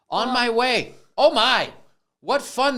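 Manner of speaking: overdone storybook glee; hammed-up delight. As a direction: gush like a thrilled kid. Excited storybook exclamation.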